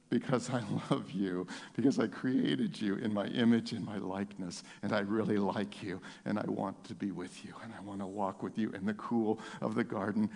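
soft, low tender voice of God. Acted. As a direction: speak it gently as a loving father. God's tender voice, softly voiced.

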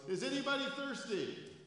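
calling out loud and high to a crowd. A narrator's reading. Jesus calling to the crowd at the feast, loud and high. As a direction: cry it out to the whole feast. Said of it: "said in a loud voice".

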